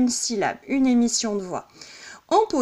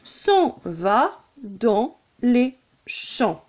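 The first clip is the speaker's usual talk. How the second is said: slow scansion, one beat per syllable; measured verse count, very slow; much slower than usual. Shown as a demonstration. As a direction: separate every syllable with a small gap. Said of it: "Ce qui va donc nous donner un".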